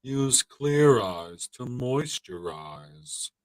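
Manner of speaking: very slow, droning pitchman; Ben Stein. Acted. Spokesman selling drops for dry red eyes, Ben Stein impression. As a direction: stretch every word in a deadpan monotone. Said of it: "you sound like Ben Stein".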